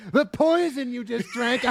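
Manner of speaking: high, giggling gloat; mock villain reveal through laughter; laughing villain line. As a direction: crack up while delivering the twist.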